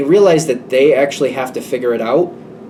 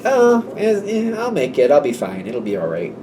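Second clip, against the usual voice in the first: flippant. Retold or imitated. The one in trouble to a worried friend, shrugging it off. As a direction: mimic a noncommittal brush-off, fading away. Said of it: "a flippant attitude about it".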